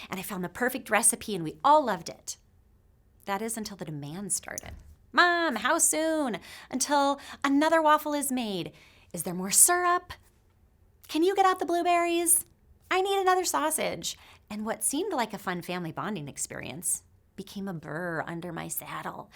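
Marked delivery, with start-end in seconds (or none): 5.1-14.2 put-on higher, louder kids' nagging voice; demanding kids. Kids pestering mom for more waffles, mock whiny nagging. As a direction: nag like impatient kids at breakfast.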